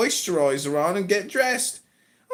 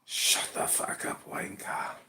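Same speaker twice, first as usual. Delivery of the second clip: put-on slow British-accented whisper; whispered British insult; 12 dB quieter, slower than usual. Tells the American to shut up, British-accented whisper.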